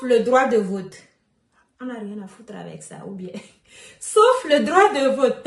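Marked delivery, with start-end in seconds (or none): 1.8-3.3 much softer, quicker throwaway mutter; muttered aside. Real, not acted. Muttered joking aside to viewers. 3.6-5.4 louder, with a laugh running through. Real, not acted. Laughs after own joking aside.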